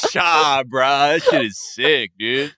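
high, loose SoCal skater drawl; skater dude voice. Retold or imitated. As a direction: play a laid-back California skater bro. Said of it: "has, like, a skater dude voice".

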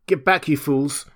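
muffled cry. A narrator's reading. Kemp ordering the crowd back, urgent cry. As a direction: cry out to push them back. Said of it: "cried the muffled voice of Kemp".

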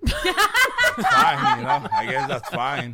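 high squealing laugh through words. Real, not acted. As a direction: crack up mid-sentence. Laughing while saying it.

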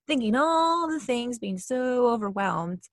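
slow, exaggerated weight on "all" and "so"; drawn-out emphasis. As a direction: stretch "all" high and long, then "so". Stretched words act out overwhelm.

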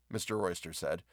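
plain reading voice

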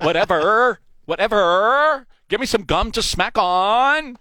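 mock teen girl smacking gum, dragging "Whatever"; valley girl up talk. Host asking if he did the up talk, mock valley girl drawl. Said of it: "Is that talking up there? How did I do?".

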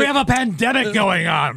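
high, loud mock indignation; hammy stage actor. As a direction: overplay the outrage for laughs. Mock outrage in the banter.

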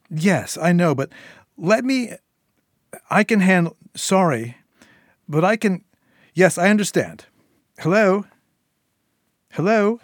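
high, loud, cut-off protests; flustered Syl on phone. Acted. Syl answering Eddie on the phone, flustered and loud.

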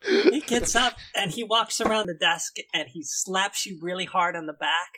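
ordinary delivery

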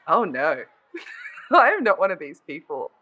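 ordinary delivery

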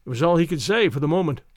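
usual speaking voice

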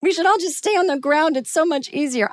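high-pitched panicky complaint; hammed-up anxious protest; acted freaking out. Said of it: "I am freaking out".

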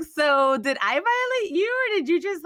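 asking her husband in mock shock; incredulous question. Wife asking husband if she violated him, mock shocked.

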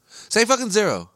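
high-pitched fed-up demand; exasperated outburst. Real, not acted. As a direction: blurt it out in indignant protest. Exasperated rant about saying nil.

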